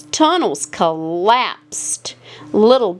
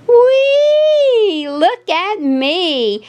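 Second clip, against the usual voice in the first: excited whee; 10 semitones higher, much slower than usual. A narrator's reading. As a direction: squeal with delight while bouncing. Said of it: "Daisy bouncing".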